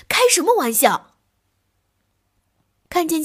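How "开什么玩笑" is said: loud, high angry bellow; a big yell of alarm and anger; roar. Acted. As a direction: roar it as a startled outburst. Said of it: "大吼一声".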